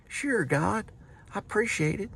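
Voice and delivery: high, polite thanks; grateful soul in heaven. Pretend. Accepting God's milkshake in a high voice.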